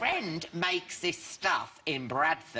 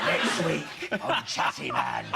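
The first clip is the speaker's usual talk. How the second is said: sex pest. Retold or imitated. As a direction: imitate a seedy presenter signing off. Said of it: "I sounded like a sex pest".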